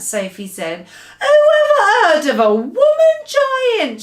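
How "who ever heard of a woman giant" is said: a loud, much higher, indignant shout. Acted. The giant scoffs at Sophie's idea and shouts high and loud. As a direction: bellow it out in disbelief. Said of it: "shouted the B F G".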